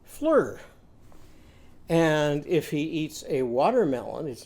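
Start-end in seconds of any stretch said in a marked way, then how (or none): 0.0-0.6 fleur said higher; fly turned to fleur; fly with er vowel. Shown as a demonstration. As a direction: swap fly's vowel to er. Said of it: "going to eat a".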